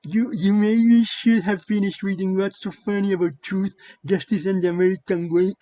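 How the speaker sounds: ape him as a dimwit all the way through; mocking dim voice. Quoting a Twitter replier on the comic panels, mocking dim-witted voice.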